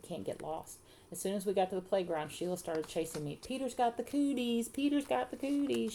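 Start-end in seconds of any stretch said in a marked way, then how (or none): 3.4-5.9 high singsong schoolyard tease; yelled taunt. A narrator's reading. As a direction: chant it at them like a teasing girl. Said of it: "she yelled".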